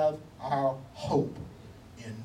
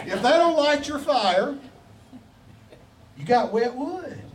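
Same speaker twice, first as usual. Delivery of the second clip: preacher punchline; 7 semitones higher than usual. Acted. Preacher teasing the congregation, playful high punchline. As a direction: throw the line out with a grin.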